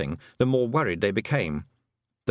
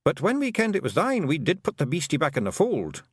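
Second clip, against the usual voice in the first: put-on high, lilting Scots-accented little man; a cheeky tiny clansman owning up; wee Scottish Feegle; 7 semitones higher than usual. Feegle chief confessing to the young witch, high Scots voice.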